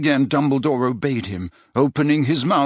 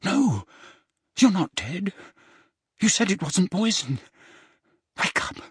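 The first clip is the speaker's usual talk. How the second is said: high, distressed pleading; frightened, disbelieving urgency; panicked denial; 8 semitones higher than usual. A narrator's reading. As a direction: plead with him to revive. Harry shakes seemingly dead Dumbledore.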